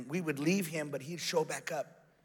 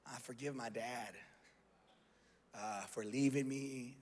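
soft, halting, mildly tearful; understated choked-up confession, low and hesitant; retold tearful prayer; 3 semitones lower, 10 dB quieter than usual. Son forgiving his dad in prayer, quiet and halting. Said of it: "I started crying".

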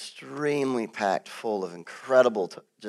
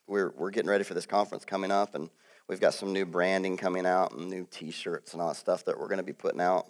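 ordinary delivery

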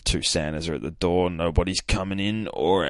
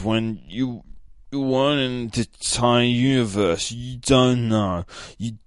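raised, louder retold rant; hammed-up indignant protest, slightly higher; mock outburst; 4 semitones higher, 5 dB louder than usual. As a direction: stammer into an overwrought mock tirade. Acted dramatic outburst in retold scene.

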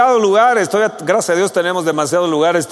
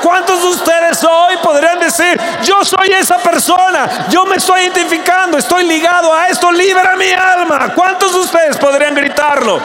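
much higher, louder shouted sermon; fiery preaching; 11 semitones higher, 5 dB louder than usual. Acted. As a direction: call the congregation out at full force. Preaching at full force. (predicación a gritos, mucho más aguda y fuerte)

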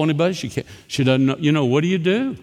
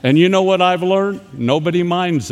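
louder and higher, mock-scolding the room; teasing call-out; 6 semitones higher, 6 dB louder than usual. Real, not acted. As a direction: call out the culprit with a grin. Teasing audience after phone rang.

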